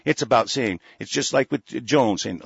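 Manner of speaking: ordinary talk, nothing marked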